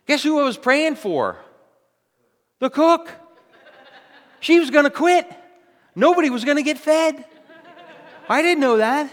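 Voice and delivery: high, loud, punchy preaching; preaching at full force. Acted. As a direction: hammer the point home to the congregation. Preaching climax.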